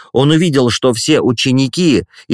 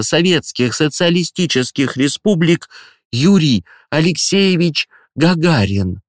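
high, ceremonial announcement to pupils; solemn headmistress; 7 semitones higher than usual. Acted. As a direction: proclaim the news with pomp. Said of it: "с театральной торжественностью".

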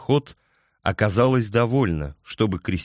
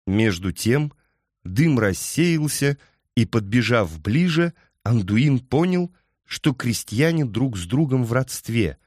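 usual speaking voice

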